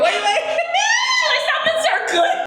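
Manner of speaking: high, shrieking laugh through the words; laughing protest. Real, not acted. Host stopping cohost's toe curl talk, laughing protest. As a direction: beg them to stop while cracking up.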